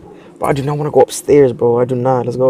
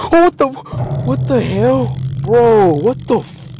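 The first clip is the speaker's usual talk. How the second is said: high, slow panicked cry; terrified shriek; 10 semitones higher, slower than usual. Real, not acted. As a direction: shriek in pure fright. Jump scare.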